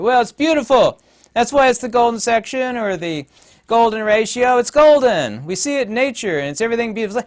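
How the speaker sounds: high, loud imitation of naive admirers; mock gushing quote. Mimicking people who call the golden ratio beautiful, mock gushing. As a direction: gush it loudly, mocking their explanation.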